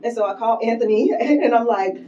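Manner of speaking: giddy and giggly. Real, not acted. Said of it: "so delirious".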